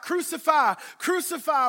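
loud, high mob shout; loud mob chant; shouting crucify. Acted. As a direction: yell it like a crowd turning. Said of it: "We shout".

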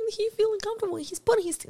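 high mocking voice for the dude. Artist mocking a guy who pretends to care, high mock voice. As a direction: squeak it out to mock him.

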